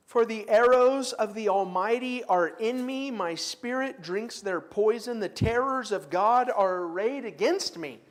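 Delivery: a forceful scripture reading in class. Acted. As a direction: declaim Job's complaint with force. Reads Job aloud.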